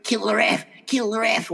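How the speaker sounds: high-pitched mock old-lady jeer; old women heckling. Old women at the football to referee, high mock heckling. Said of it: "All these old women just sitting there".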